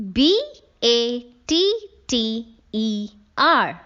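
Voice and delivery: slow, letter by letter; spelling out. Shown as a demonstration. Teacher spelling a word for pupils to underline, letter by letter. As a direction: spell it out slowly, each letter set apart.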